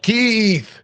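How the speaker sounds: sudden high joyful shout of a name; overjoyed cry of a name; yelled name. Retold or imitated. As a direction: call his name out in elated disbelief. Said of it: "And I just yelled out".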